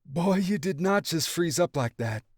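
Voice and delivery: a hint of a teasing mom; lightly voiced laughing mom. A narrator's reading. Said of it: "Daisy laughed".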